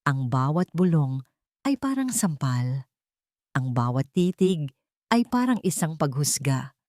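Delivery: ordinary delivery, nothing marked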